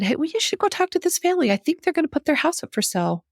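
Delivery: imitating the bishop. Bishop tipping off her daughter about a house, mock cheery voice. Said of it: "and he said".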